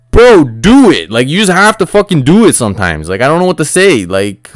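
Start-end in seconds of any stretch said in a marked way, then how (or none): 0.1-2.5 loud, high-pitched exasperated plea; frustrated fan calling out; exasperated urging. Acted. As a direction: yell at the player to act. Exasperated plea to absent player.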